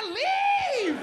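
high, sharp dismissal; curt wife. Acted. Wife dismisses husband who threatens to leave. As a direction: throw it back at him. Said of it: "She said".

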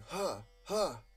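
two high, sharp narrated cries; loud exclamation. A narrator's reading. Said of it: "and shouts loudly, exclaiming".

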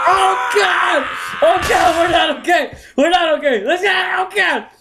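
high shouts of alarm; panicked shouting. Real, not acted. Gamer shouting in panic mid-fight, we're not okay. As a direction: yell it like everything is falling apart.

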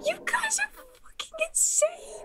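high breathless whisper of disbelief; stunned whisper. Real, not acted. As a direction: whisper it, floored. Stunned reaction to chat.

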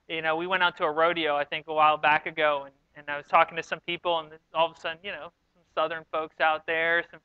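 ordinary delivery

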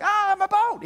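high, loud wail over the lost boat; sudden high-pitched dismay; dismayed boy's cry. Retold or imitated. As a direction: cry out like a heartbroken kid. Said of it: "He's like".

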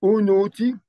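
Unuti called out high and loud; pronounced unuti. Shown as a demonstration. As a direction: announce Unuti clearly. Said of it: "the next word is unuti".

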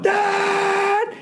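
high sudden yell; mock shout. Comic exclaiming at the touchback.

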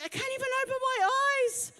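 high, fast, whiny groan; whiny hungover moan. Retold or imitated. Sugar hangover, quoted self.

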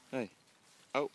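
ordinary talk

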